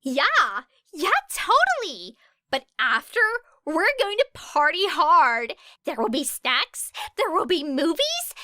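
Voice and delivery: high, eager and loud; fervent Ashido. A narrator's reading. Ashido promising a big party, high and eager. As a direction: bounce with eager agreement. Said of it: "nodded fervently".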